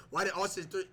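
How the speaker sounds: normal talking voice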